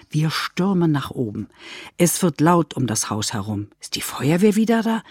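usual speaking voice

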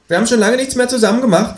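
high, loud reproachful kangaroo voice. Acted. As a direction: whine it like a hurt kangaroo. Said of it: "in vorwurfsvollem Ton". (hohe, vorwurfsvolle Känguru-Stimme)

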